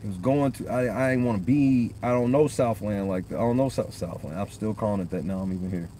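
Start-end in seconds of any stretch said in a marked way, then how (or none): none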